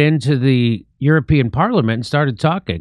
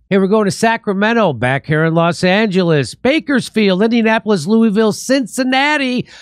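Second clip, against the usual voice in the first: higher, upbeat list of cities; tour announcement; 7 semitones higher than usual. Real, not acted. Comedian hypes his tour cities to the audience, upbeat and higher. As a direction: sell the tour dates with energy.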